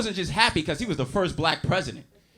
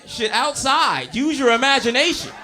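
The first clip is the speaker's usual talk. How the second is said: punchline shout; 6 semitones higher, 5 dB louder than usual. Real, not acted.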